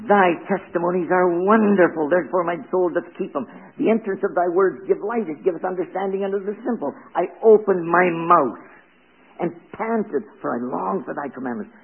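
solemn, emphatic reading of verses; scripture reading. Acted. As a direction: proclaim the verses. Reads verses from the psalm.

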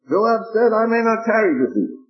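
scripture reading. Acted. Preacher reading the Bible to his congregation, measured. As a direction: read the verses out to the congregation.

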